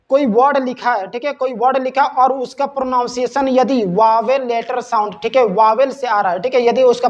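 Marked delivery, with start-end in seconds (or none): none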